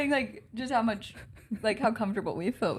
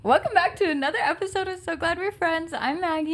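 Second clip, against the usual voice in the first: bright, higher host welcome; podcast intro; 7 semitones higher, 3 dB louder than usual. Acted. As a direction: open the show with energy.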